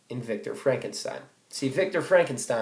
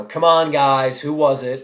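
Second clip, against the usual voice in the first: calling out; 2 semitones higher, 8 dB louder than usual. Retold or imitated. Boys calling out to friends.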